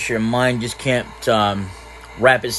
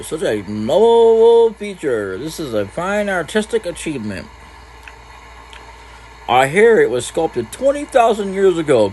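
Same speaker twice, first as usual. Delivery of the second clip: swooping, theatrical admiration; hammed-up awe at a statue; pompous art admirer; 5 semitones higher than usual. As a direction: draw out the praise like a grand connoisseur. Voices an art-admiring game character.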